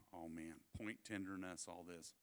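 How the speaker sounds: low, very soft aside; retold doctor murmuring over an injury; concerned murmur. Voices doctor wife examining son.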